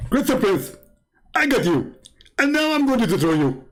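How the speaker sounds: high put-on voice, gloating; a squeaky mocking caller taunting the host; mock Zakir Naik. Prank caller taunts the host in a high mock voice. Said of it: "I'm Zakir Naik".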